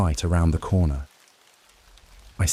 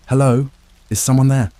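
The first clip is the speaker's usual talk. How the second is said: calling out, voice cracking; voice breaking; 7 semitones higher, 6 dB louder than usual. A narrator's reading. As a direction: call out into the dark, voice breaking. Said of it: "my voice breaking the thick silence".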